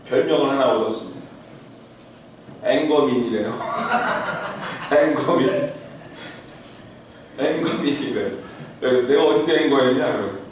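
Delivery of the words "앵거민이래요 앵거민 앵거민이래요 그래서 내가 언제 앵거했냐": laughing. Real, not acted. Laughing retelling kids' nickname.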